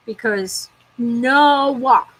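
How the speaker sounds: very slow, stretched stubborn refusal; drawn-out pouty no. Real, not acted. Sulking at the clouds, a stretched pouty no.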